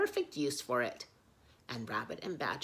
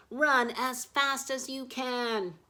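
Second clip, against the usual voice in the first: high, loud, slow warning shout; urgent cry; 10 semitones higher, 8 dB louder, slower than usual. Acted. Hidden animals cry out to Little Owl, urgent. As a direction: shout for him to flee. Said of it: "cried the animals".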